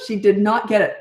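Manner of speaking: usual speaking voice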